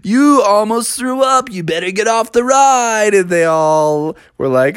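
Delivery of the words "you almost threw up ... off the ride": pitched-up heckling voice; mocking ride operator. Ride operator jeering at sick Mother, higher mocking voice. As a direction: taunt her, higher with a laugh. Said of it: "the dude running the ride".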